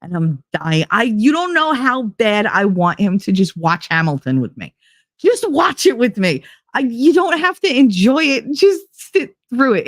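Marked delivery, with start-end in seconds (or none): 5.2-9.8 pleading. Retold or imitated. Pleading with partner to watch.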